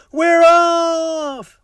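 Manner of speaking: very high, loud group cheer; crew shouting. A narrator's reading. The crew cheering as they set sail, high loud shout. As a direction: shout it like the whole crew at once. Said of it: "we all shouted".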